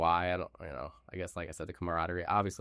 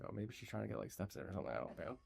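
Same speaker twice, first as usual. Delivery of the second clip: quiet inner thought; 13 dB quieter than usual. Retold or imitated. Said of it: "I'm like".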